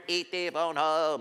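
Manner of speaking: slow, strange little alien voice; lightly mimicking the alien asking to go home. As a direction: croak it like the movie alien. Showing picture of E.T.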